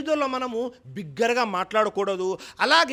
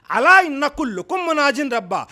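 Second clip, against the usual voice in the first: louder Arabic recitation of the hadith; louder, intoned Arabic hadith; 3 semitones higher, 7 dB louder than usual. Acted. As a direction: lift the voice and recite the Arabic words.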